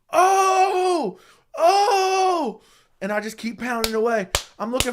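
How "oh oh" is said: high, loud excited commentator yell; Joe Rogan yell. Retold or imitated. Joe Rogan hollering at a brutal finish, high loud yell. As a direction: yell it like a cageside commentator losing it. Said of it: "Joe Rogan going".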